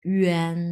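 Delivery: ইয়ান starting on a tight rounded ü; slow ü-en demo on ইয়ান; pressed pronunciation. Shown as a demonstration. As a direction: press the cheeks into ইয়ান, drawn out slowly. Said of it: "একটু pressure দিয়ে উচ্চারণ".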